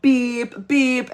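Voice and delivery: high, loud imitated bleep; censor beep. David's naughty word censored, high imitated beeps. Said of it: "He was like".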